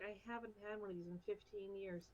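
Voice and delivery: soft, low mumble to oneself. Acted. Drinker muttering over a toffee apple, mumbled. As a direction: mumble it quietly, pitched low. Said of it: "just kinda mumble".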